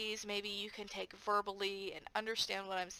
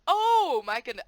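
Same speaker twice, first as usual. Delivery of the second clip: louder mock amazement; 5 semitones higher, 11 dB louder than usual. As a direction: play up being amazed for the kids. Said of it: "I'm like".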